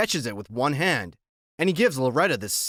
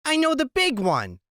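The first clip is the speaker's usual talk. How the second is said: a higher, put-on woman's voice; 12 semitones higher, 3 dB louder than usual. A narrator's reading. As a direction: voice the squinting woman, pitched up. Loretta voiced in the story.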